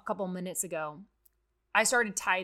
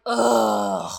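loud, put-on groan of a sulky kid; a fed-up kid's ugh at chores; grumbling child groan; 9 dB louder than usual. A child asked to take out the trash, loud sulky groan. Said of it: "do it grumbling".

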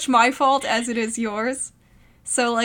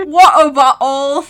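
loud mock cockney British shout; hammed-up cockney British yell; bad British accent; 10 dB louder, slower than usual. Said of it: "my British accent".

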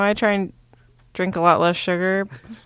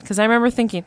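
usual speaking voice

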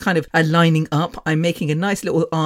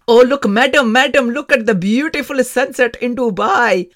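pushy tour guide; 6 semitones higher, 6 dB louder than usual. Retold or imitated. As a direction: ham up an eager tout. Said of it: "the man is going".

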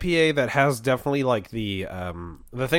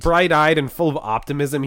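ordinary talk, nothing marked

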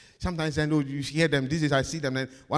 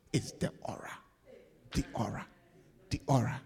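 hushed whisper; 6 semitones lower, 7 dB quieter, faster than usual. Acted. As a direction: whisper it, softer and lower. Preacher drops to hush.

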